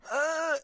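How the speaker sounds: high strained groan, imitating the man; a hammed-up pained uh. Stricken man groaning to promo girl, imitated high groan. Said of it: "he's like".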